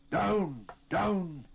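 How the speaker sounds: high, forceful gasped cry; breathless, high-pitched bark of alarm; gasped shout. A narrator's reading. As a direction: gasp it out as a loud command. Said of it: "he gasped".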